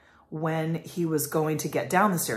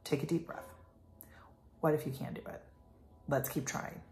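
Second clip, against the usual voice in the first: calm coaching; 2 semitones lower, 6 dB quieter than usual. Retold or imitated. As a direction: settle him down gently. Mom calming frustrated child.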